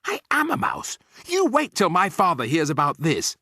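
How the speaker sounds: high, loud indignant squeal; aghast and high-pitched; horrified outcry. A narrator's reading. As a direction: wail the discovery, then threaten loudly. Bruno cries out at his paws.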